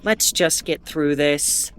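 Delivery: weary, beaten mutter; resigned. Acted. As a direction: drag yourself back to the script. Resigned after being censored.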